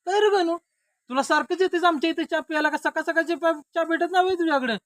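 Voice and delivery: very high, loud teasing questions; loud needling. Acted. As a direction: needle the visiting friend at full voice. Host needles friend over morning tea. (चढ्या आवाजात टोमणे)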